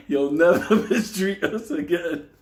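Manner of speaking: loud, high, slow declaration through laughter; laughing mock vow. Friend vowing revenge for the doll, laughing mock defiance. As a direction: cry it out defiantly while cracking up.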